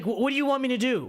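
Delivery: ordinary delivery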